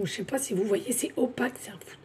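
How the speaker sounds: dropped to a near whisper; whispered, confiding aside; hushed aside. Real, not acted. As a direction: lean in and breathe it like a secret. Hushed aside about the card.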